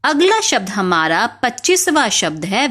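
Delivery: ordinary talk, nothing marked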